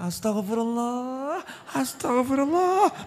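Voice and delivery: high, drawn-out mock wail, kept soft; istri berteriak istighfar. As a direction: stretch it out like a put-upon wife's mock cry. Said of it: "ibu teriak". (ratapan istri yang dibuat-buat, tinggi dan panjang)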